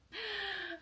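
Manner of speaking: high excited gasp. Real, not acted. Gasping with delight as she holds up the shirt.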